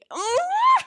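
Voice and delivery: very high shrieking laugh; a shrieking giggle of delight; giddy squeal laugh. Real, not acted.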